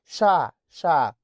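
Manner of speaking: slow stretched sh; sh sound. Shown as a demonstration. Teacher demonstrating the sh sound. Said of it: "شين على طول".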